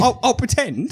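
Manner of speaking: a hint of a sheepish partner; mock partner. Partner promising to fake surprise, mock voice. Partner quoted, much higher.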